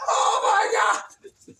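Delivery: high, loud yell; hammed-up panic; panicked shout. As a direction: scream it as water rises. Characters panic in flood.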